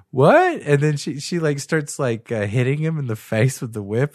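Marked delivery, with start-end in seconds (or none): 0.1-0.6 high, loud startled yelp; mock shocked what. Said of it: "And he was like".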